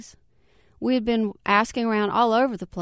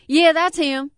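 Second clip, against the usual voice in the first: a touch of a snarl, higher; mild snarl; 8 semitones higher, 5 dB louder than usual. A narrator's reading. Larger man snarls at the woman, a touch of a snarl. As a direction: snap it out lightly. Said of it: "snarled the larger man".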